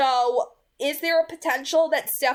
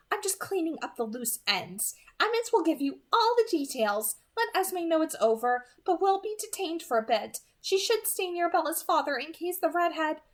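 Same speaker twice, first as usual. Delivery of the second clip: Alice on the phone; 6 semitones higher than usual. Acted.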